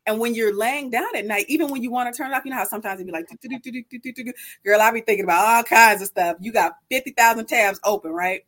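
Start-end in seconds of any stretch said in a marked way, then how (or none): 3.3-4.3 imitated chatter of busy thoughts, kept quiet; racing-mind noise. Host imitating her mind at night when it won't turn off, soft chatter. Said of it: "process thought at a fast rate".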